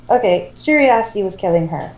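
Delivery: usual speaking voice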